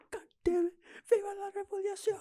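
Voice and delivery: high squeaky imitation of Bob's yell; mock Bob yell. Friend imitating Bob's revolution cry in a high mock yell. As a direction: shriek it like a cartoon of Bob. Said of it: "the way Bob yelled".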